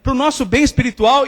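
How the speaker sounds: ordinary talk, nothing marked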